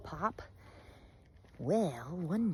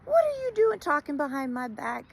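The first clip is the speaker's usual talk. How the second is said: high, loud indignant challenge; confronting accuser; 4 dB louder than usual. Acted. Animal confronting the accuser, indignant. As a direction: demand answers from the gossip. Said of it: "the accuser was and say".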